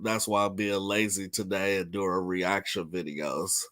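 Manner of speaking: ordinary delivery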